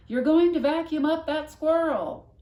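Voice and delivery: loud high warning cry; shouting. A narrator's reading. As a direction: yell it in alarm. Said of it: "you would shout".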